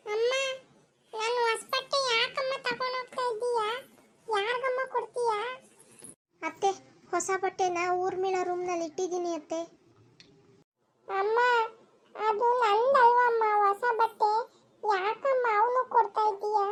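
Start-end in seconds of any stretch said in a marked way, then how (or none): 0.1-5.4 put-on higher, whiny little boy's voice; a boy upset that his clothes are being given away; young boy. Child asks mother about clothes. 11.1-16.7 put-on a boy protesting that his new clothes are his; young boy. Child protests to mother.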